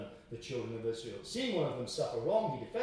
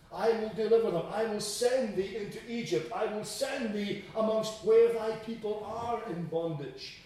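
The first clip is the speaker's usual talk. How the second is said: raised, emphatic scripture proclamation; ringing pulpit declaration; forceful preaching; 6 semitones higher, 6 dB louder than usual. Acted. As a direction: proclaim it as a divine promise. Preaching, proclaiming God's words.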